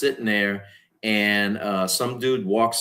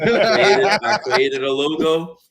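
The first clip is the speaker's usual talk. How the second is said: laughing; 6 semitones higher, 5 dB louder, slower than usual. Real, not acted. Laughing mid-story.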